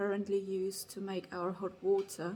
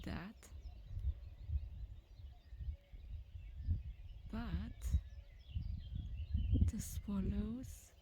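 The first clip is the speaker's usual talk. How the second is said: whispering; 4 dB quieter than usual. Real, not acted. Whispering beside the swallows' nest.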